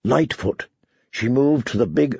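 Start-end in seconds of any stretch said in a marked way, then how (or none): none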